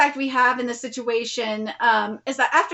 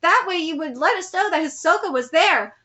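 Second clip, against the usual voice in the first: ordinary talk, nothing marked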